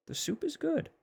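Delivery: extremely high falsetto squeak. Pretend. Repeats it even higher.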